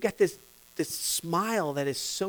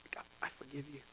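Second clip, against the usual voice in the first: a hushed, softened prayer; soft; 4 semitones lower, 21 dB quieter, faster than usual. Retold or imitated. Worshipper feeling God's love, quietly. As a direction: say it barely above a breath. Quoting a forgiven person.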